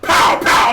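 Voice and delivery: loud breathy double pow; two quick shots, hard and loud; mock gunshots. Retelling the cop shooting again, loud double gunshot.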